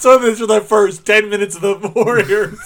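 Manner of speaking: high, loud, laughing objection; laughing protest. Real, not acted. Host defending spoilers for the first ten minutes, laughing protest. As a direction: protest loudly while cracking up.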